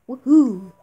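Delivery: a loud burst of joy; excited cheer. Real, not acted. Player cheering after buying the adventure armor, loud whoop.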